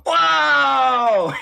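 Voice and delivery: high, loud whoa; surprised shout. Real, not acted. Player shouting at the newcomers in surprise. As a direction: burst out in surprise.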